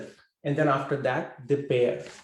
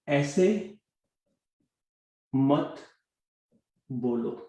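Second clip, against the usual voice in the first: ऐसे, मत, बोलो set apart by long pauses; word by word. Shown as a demonstration. Teacher giving Hindi learners a simple sentence, word by word. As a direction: say ऐसे, then मत, then बोलो, pausing well after each.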